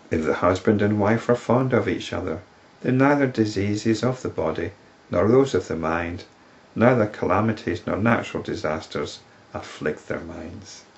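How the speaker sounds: put-on measured, solemn reading of an old text; scripture reading. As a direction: read it like sacred verse. Reads scripture verses aloud.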